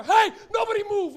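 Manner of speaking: loud high command, barging in; mock police raid shout; cop shout. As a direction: bark it like a raiding cop. Cops bursting in, acted.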